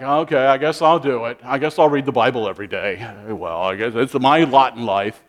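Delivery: reluctant churchgoer grumbling to himself; grumpy guy. Retold or imitated. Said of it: "like a grumpy guy".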